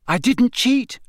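high, loud sharp denial. A narrator's reading. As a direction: snap it back, high and loud. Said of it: "said Harry sharply".

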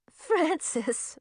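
high-pitched giggly answer; lightly amused, pitched high; giggling. A narrator's reading. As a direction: say the name through a light giggle.

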